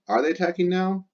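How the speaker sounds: high, sing-song teacher question; asking voice. Shown as a demonstration. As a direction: pitch it up like asking the class. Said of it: "yes or no question".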